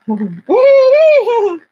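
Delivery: loud, high hooting laugh; dumbest laugh. Real, not acted. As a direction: burst into a silly, high-pitched laugh. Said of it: "the dumbest laugh I've ever done".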